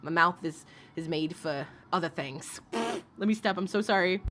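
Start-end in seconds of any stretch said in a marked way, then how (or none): none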